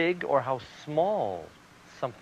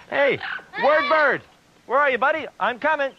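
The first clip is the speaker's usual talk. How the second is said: much higher, louder calling voice; 10 semitones higher, 5 dB louder than usual. Acted. Puppet calling out for his buddy word bird. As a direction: call out brightly for a friend.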